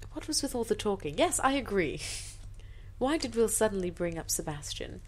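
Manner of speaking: usual speaking voice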